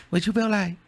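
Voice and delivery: high, even mimicry of a rapper; calm rapper impression. Showing how the rapper said it, calm and high. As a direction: repeat it coolly, like the rapper. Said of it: "It was very calm".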